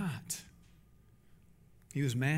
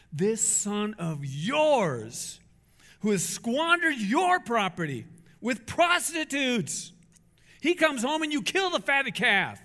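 slow, higher and louder outrage; outraged son; 4 semitones higher, 4 dB louder, slower than usual. Acted. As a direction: spit out each word in disgust. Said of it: "He is so outraged".

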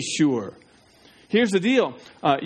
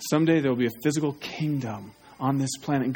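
ordinary talk

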